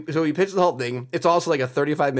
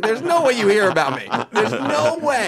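high, loud, laughing disbelief; incredulous protest; 10 semitones higher, 7 dB louder than usual. Retold or imitated. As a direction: squeal it out in disbelief. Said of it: "I was like".

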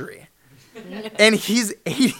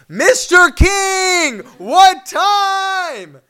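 slow, loud, high drawn-out shout; 12 semitones higher, 11 dB louder, much slower than usual. Retold or imitated. Fan shouting at Larry King through the car, loud slow shout. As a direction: bellow through the car door.

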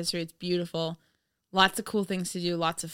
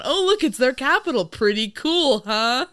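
condescending; 5 semitones higher, 5 dB louder than usual. Retold or imitated. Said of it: "throwing the Americans a bone".

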